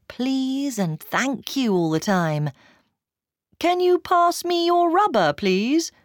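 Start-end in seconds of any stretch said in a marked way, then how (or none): 3.6-5.9 higher, lightly acted polite request; a touch of over-polite asking; polite English classmate. A narrator's reading. English classmate politely asking to borrow a rubber, lightly over-polite.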